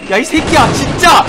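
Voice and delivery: angry yell. Real, not acted. Frustrated yelling at game.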